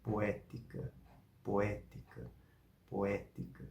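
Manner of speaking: "poética" soft, with O and E as separate syllables; vowels apart. Shown as a demonstration. Teacher shows the hiatus, each vowel said separately. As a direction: split "poética" into po-é-ti-ca, quietly. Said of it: "cada uma das vogais vai ser pronunciada".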